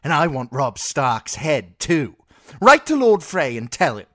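put-on a furious spoilt child-king throwing his weight; spoilt boy king. Boy king orders death.

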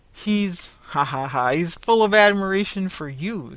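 loud, jolly laugh breaking into the line. A narrator's reading. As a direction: laugh heartily while teasing the wife. Jovial carrier laughs at wife.

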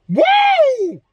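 high whooping shout; hype woo. Real, not acted. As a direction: let out a celebratory woo. Hype yell at mirror.

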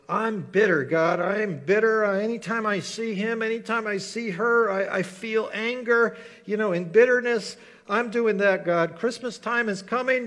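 loud, high, seething mock complaint to God; bitter rant. Preacher acting out a bitter believer ranting at God, loud and seething. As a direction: vent it like a resentful person stewing for years. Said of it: "just choose to be bitter all you want".

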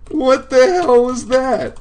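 high, loud, drawn-out disbelief; shocked shriek. Real, not acted. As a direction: yell it out high in astonishment. Shocked by game event.

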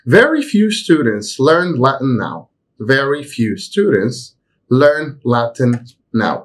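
slow, careful example reading; slow model sentence. Shown as a demonstration. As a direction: read it slowly for students to follow. Teacher reads example sentence slowly. (جملة مثال ببطء ووضوح)

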